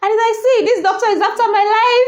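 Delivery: high, helpless laughing voice; laughing hard. Real, not acted. Vlogger cracking up over a funny tweet reply, laughing. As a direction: talk through real giggles.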